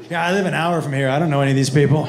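low-pitched, casual shrug of a reply; unbothered man. Retold or imitated. Cheating man answering the comic, low and unconcerned. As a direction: brush it off, low and easy.

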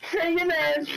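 high, loud strained shout; shouted send-off. Real, not acted. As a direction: yell it after the enemy. Shouted at enemy in game.

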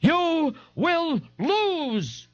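very high, drawn-out cry; slow high scream, held back in volume. A narrator's reading. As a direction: stretch each word in a high wail.

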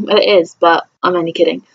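ordinary talk

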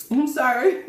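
apologizing through a mouthful; mouth full. Real, not acted. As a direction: talk around a bite of food. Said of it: "I'm just eating".

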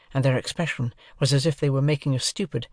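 ordinary delivery

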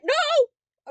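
loud, high cry of refusal; explosive high outburst; shouted no. Real, not acted. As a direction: yell it in sudden despair. Outburst at the game.

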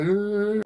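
mock wounded grunt; retold groan. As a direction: imitate the hit driver's groan. Wounded driver voiced in story.

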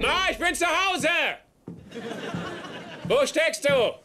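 calling out. Real, not acted. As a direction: call out to mom from the front door. Ruft durchs Haus nach Mutter. (ruft laut durchs Haus nach der Mutter)

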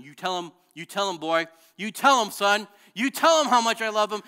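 rising, excited shouted encouragement; building proud shout of encouragement; God cheering loudly. Retold or imitated. God voiced cheering the speaker on.

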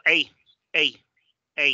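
A said as the English "ay" diphthong; English letter A. Shown as a demonstration. Teacher showing the vowel sound, English "ay" for A. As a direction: say A like English "ay", each one set apart. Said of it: "es el sonido A".

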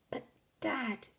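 put-on small, high boy's voice; pleading son. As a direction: timidly try to interrupt Dad.

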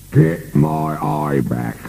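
slow, high-pitched monster demand; terrifying booming voice. A narrator's reading. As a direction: demand it as a monster master, dragging each word. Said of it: "boomed the terrifying voice from upstairs".

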